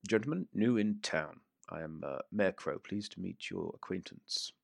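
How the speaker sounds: soft, sneering mayor; a hint of an impatient sneering gentleman; lightly voiced haughty mayor; Mayor Crow voice. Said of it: "and he just says".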